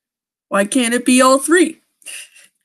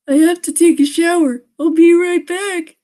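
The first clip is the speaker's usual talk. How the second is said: higher, louder put-on girl voice; girlfriend voice; 8 semitones higher, 5 dB louder than usual. Kate telling her boyfriend she's going to shower, in a higher, louder voice. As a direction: play her in a pitched-up voice.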